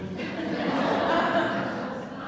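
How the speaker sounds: airy high giggle, half under breath; a quick breathy chuckle at herself; breathy nervous laugh. Real, not acted. Nervous laugh after thought.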